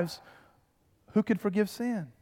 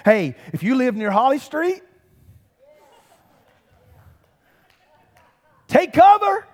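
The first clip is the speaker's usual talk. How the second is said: news voice rising to a loud, much higher cry on take cover; urgent warning; 6 semitones higher, 6 dB louder than usual. Retold or imitated. TV anchor warning viewers of the tornado, rising to a loud urgent cry. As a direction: sound the alarm like a TV newsman. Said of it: "are saying".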